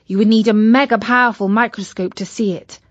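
ordinary delivery, nothing marked

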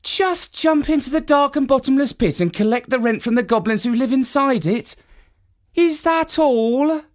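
drip sarcasm on every word; sarcastic. Acted. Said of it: "asked Finette sarcastically".

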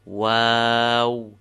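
Wow with a long, level ah gliding into w; extended alif. Shown as a demonstration. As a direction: stretch the ah before the final w of Wow. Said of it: "there's an alif in between".

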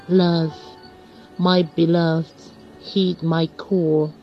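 ordinary talk, nothing marked

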